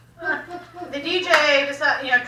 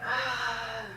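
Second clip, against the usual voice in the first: low, soft, unmoved questioning; stoic; 5 semitones lower, 8 dB quieter than usual. Retold or imitated. Imitating stoic Faye questioning a frantic dancer, deadpan. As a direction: ask it quietly, completely unfazed. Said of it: "the most stoic little sweet lady".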